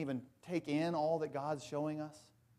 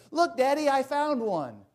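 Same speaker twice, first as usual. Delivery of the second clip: much higher and louder gleeful squeal; excited girl; 10 semitones higher, 9 dB louder than usual. Retold or imitated. Veruca showing her father the golden ticket, high excited squeal. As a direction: show off the prize like a delighted child. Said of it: "and she goes".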